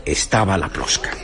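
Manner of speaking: normal talking voice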